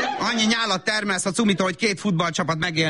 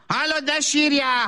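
shrill scolding; 9 semitones higher than usual. Acted. Mother scolds crying toddler.

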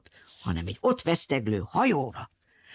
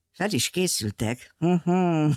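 usual speaking voice

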